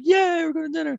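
put-on high, squealing kid cheer; excited kid. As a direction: cheer it like a thrilled little kid. Kids cheering about dinner.

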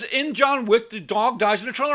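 very high, loud snap; angry friend. Retold or imitated. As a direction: fling it back impatiently. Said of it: "He says".

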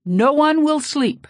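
high, loud, slow shriek. A narrator's reading. Witch doctor leaps up shrieking at the meeting, drawn-out shriek. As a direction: screech the curse at them. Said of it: "Tata Kuvadundu suddenly shrieked".

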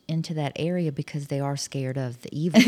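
usual speaking voice

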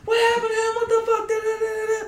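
high, sing-song panicked mother; mock frantic mom cussing. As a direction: mimic mom yelling in alarm. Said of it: "all you hear is my mom".

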